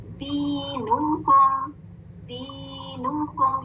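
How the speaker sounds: slow दीनुकुम, long "dee", rounded "noo-koom"; drawn-out recitation. Shown as a demonstration. Teacher modelling the word for pupils, slow drawn-out recitation. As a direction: hold each syllable of दीनुकुम on a level tone. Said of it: "दी टू counts देना है".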